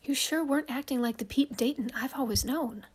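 hushed worried whisper; urgent whisper. Acted. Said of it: "She whispers urgently".

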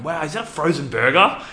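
high, loud wide-eyed wonder; excited child. Retold or imitated. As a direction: imitate a thrilled kid spotting a treat. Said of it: "Bella was so excited".

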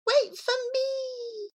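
high, slow, soft wail; long wailing meow. Acted. Cat calling after her mistress, slow high wail. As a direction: stretch it like a cat crying. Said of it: "the long, wailing".

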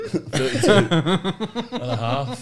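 slow, giggling repeat; repeating the age while cracking up; laughing. Real, not acted. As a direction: say it again through laughter.

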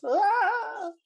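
high, loud mock scream. Retelling the boy's first words to friends, a mock scream. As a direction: let out a hammed-up scream. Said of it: "and he goes".

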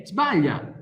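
usual speaking voice

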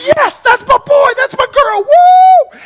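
high, loud joyful cheer; hammed-up whoop of delight; proud cheering. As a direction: cheer like a thrilled parent.

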